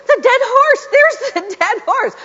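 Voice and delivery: high, loud excited cry with a laugh; excited screaming. Retold or imitated. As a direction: shout it out in amazed excitement, laughing. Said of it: "I started screaming".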